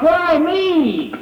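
high, drawn-out cry of anguish; imitated scream of protest. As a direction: cry it out in pain, acted. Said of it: "he was screaming out".

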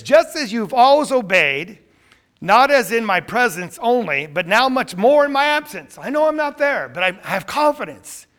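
loud, fervent sermon delivery; preaching. Real, not acted. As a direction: preach it out with full force. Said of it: "I see a sermon coming on right now".

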